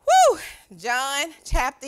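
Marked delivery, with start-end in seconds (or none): 0.1-0.4 loud, high-pitched "woo!"; praise whoop. Real, not acted. Preacher returning to John chapter eleven, loud high whoop. Shakes off emotion, resumes sermon.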